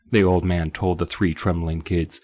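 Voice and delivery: normal talking voice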